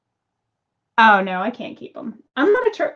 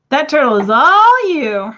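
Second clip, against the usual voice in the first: emphatic; 5 semitones higher, 8 dB louder than usual. Real, not acted. Offering turtle to viewers.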